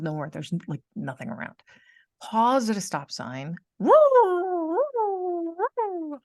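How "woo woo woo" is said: high loud siren wail, woo woo; police siren. Retold or imitated. Pulled over at a stop sign, a siren imitation.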